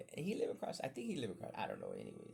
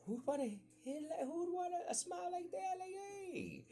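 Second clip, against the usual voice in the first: much higher, squeaky innocent voice; hammed-up innocence. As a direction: pitch it way up like a grinning kid.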